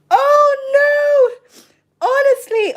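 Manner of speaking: loud, high wail of laughter; crying laughing. Real, not acted. Streamer reading a comment, crying with loud laughter. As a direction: howl it through tears of laughter.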